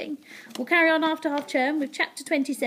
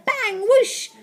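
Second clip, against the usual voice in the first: high, loud bang and whoosh; blast noises; 12 semitones higher, 7 dB louder than usual. A narrator's reading. Narrator fires Ned from the slime cannon, excited sound effects. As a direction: punch out the blast and the flight.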